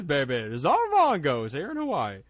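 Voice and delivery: big showy put-on wrestler voice; Czar Mongo impression. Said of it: "he talked like this".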